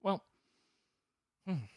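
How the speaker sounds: high, chill agreeable voice; God as an easygoing buddy; laid-back God voice. Retold or imitated. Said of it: "He's like".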